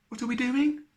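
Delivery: high, cheery small voice; little rabbit voice. A narrator's reading. Little rabbit cheerfully calling from behind the rescuers. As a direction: pop up brightly behind them.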